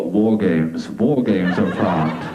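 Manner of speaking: low, slow doom voice; deep movie-trailer menace on war games; ominous war games. Acted. Telling the audience, low slow ominous war games. Mocking the woman's hidden agenda.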